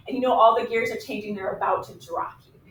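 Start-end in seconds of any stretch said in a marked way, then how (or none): none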